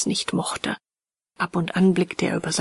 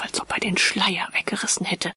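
plain reading voice

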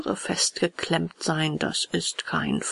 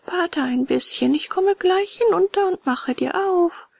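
high-pitched snail voice; high, unhurried snail; snail at window; 12 semitones higher than usual. A narrator's reading. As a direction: promise it from above, pitched high. Unhurried snail replies from above.

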